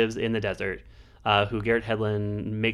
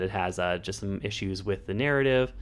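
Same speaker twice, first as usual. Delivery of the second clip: usual speaking voice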